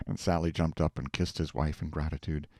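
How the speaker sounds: usual speaking voice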